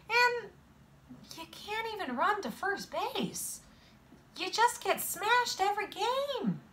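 high-pitched, exasperated list of failings; exasperated scolding. A narrator's reading. Friend confronting boy after losing the game, high and exasperated.

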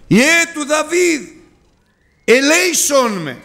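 slow, high plea; high, drawn-out cry; blind man crying out. Retold or imitated. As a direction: cry out for mercy. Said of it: "άρχισε να φωνάζει".

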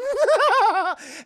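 loud, high villain cackle; maniacal cackle. Retold or imitated. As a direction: burst into an evil laugh. Villain gloats.

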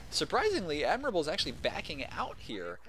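usual speaking voice

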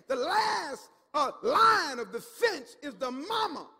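loud, building preacher's voice; full-force preaching. Acted. Preacher voicing the devil's plan, loud and rising. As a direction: drive the warning home.

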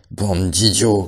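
loud furious «Bande d'idiots!»; angry shout. A narrator's reading. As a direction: bark «Bande d'idiots!» at the herd. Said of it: "cria Benjamin".

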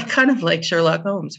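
laughing through the words; amused, laughing reply. Real, not acted. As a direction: chuckle as you agree. Laughing agreement.

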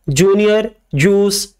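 ordinary delivery

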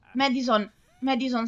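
ordinary talk, nothing marked

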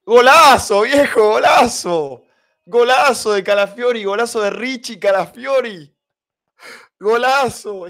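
shouted golazo, high and loud; goal scream. Real, not acted. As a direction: celebrate the goal at full voice. Goal celebration while watching.

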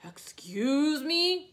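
slow, high, loud drawn-out call from above; booming whale. Acted. Reading to kids, whale calling down to Piggy and Gerald. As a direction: boom it down like a giant.

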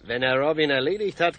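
ordinary talk

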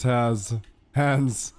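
normal talking voice

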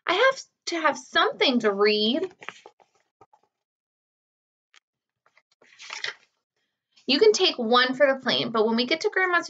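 lively storybook reading with character voices; reading to children. A narrator's reading. Teacher reading the chapter book to her students. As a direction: read it to a class of kids.

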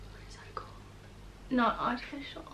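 whispered, muttering to herself; under-the-breath whisper, thinking aloud; whisper to self. Real, not acted. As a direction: whisper it while hunting for the word. Muttering to herself, searching word.

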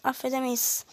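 usual speaking voice

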